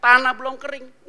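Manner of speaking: ordinary talk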